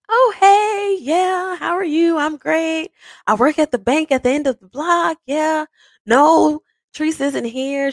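overly sunny voice covering sadness; fake cheerful. Running into Martia, faking being fine: high fake cheer. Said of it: "faking it real good".

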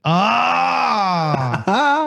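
sudden high yelp of surprise; startled cry. Real, not acted. Host cut off mid-sentence, startled high yelp. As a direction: yelp as if something just startled you.

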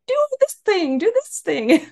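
high mock-bossy demand; bossy boss. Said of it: "Now she's like".

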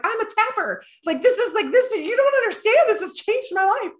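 high, loud gushing convert voice; gushing client. Retold or imitated. Client raving to therapist, high excited gush. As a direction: squeal it like a new believer. Client after tapping, crying.

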